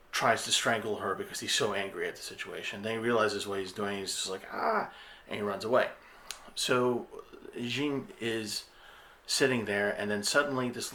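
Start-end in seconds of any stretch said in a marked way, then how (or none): 4.5-4.9 sharp high yelp of horror; anguished cry. Retold or imitated. Husband realizing he is strangling his wife, high anguished cry. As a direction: cry out as you catch yourself.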